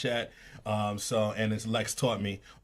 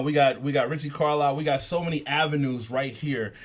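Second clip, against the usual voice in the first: ordinary talk, nothing marked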